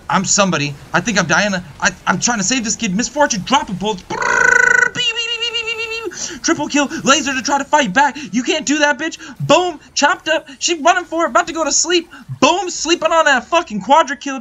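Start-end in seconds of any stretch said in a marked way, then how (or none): 4.1-6.4 high rolled brrr into fading beeps; imitated gunfire noise. Commentator mimicking Misfortune's bullet barrage, high fading sound effects. 6.4-14.4 high-pitched, excited kill calls; hyped play-by-play. Real, not acted. Gamer calling his own quadra kill, hyped and high.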